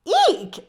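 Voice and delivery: high, loud startled eek; squeal. Acted. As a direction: shriek as the bird snatches the thread. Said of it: "squealed Rosie".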